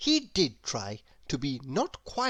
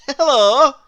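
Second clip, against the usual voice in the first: higher, louder bright hello; cheery grinning greeting; 8 semitones higher, 12 dB louder than usual. Acted. Mister Happy greeting Mister Grumpy with a grin. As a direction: beam it out in a happy character voice. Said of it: "grinned Mister Happy".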